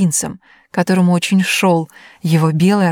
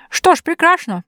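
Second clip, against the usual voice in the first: put-on high, mumbling old giant; шамкающий старик; 10 semitones higher than usual. A narrator's reading. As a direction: mumble it like a toothless old man. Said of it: "прошамкал великан". (шамкающий старый великан)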